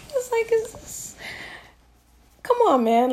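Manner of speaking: high, loud, fed-up plea; exasperated. Real, not acted. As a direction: beg in disbelief.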